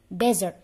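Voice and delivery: "Desert" with a buzzing Z, DEZ-ert; loud model word "Desert", stress on DEZ. Shown as a demonstration. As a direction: punch "Desert" out loudly for the class. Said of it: "S-nya kita jadikan Z".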